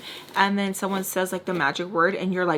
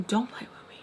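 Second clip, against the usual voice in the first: whispered mock warning; covert warning; 9 dB quieter than usual. Warning her friend while he looks away, whispered. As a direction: act out a hushed, secret threat. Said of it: "talking to her like that".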